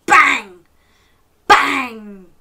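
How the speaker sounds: explosive, falling door slam; big, punchy storytime sound effect; loud bang. Shown as a demonstration. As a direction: shout it like a slamming door. Models slamming noise for children.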